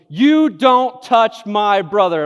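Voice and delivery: slow, loud, high warning from a big brother; older brother warning the bully off, loud and slow; protective threat. Retold or imitated. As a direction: lay down the law, each word slow and loud. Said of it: "Charles goes".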